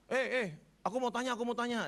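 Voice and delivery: high-pitched Kediri Chinese friend; high, eager Kediri-accented voice; Kediri accent imitation. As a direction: mimic a Kediri friend butting in. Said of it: "Dia orang Kediri".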